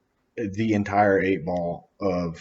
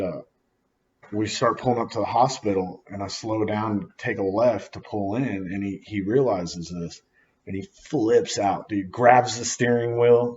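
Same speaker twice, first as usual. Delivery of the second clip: ordinary talk, nothing marked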